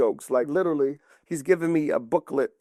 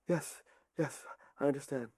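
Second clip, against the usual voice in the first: much softer, meek knight's reply; obedient assent; 13 dB quieter than usual. Acted. Sir Galahad answering his king, soft obedient assent. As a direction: quietly give in to the king. Knight meekly accepts orders.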